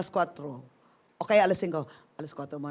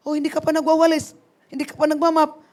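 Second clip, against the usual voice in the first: mock scolding; 7 semitones higher, 12 dB louder than usual. Acting out self-reproach.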